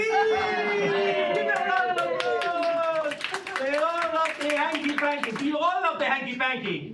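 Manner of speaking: high, loud, drawn-out hype; rally the whole room at full voice; rousing shout. Real, not acted. Comedian working up the audience, rousing shout.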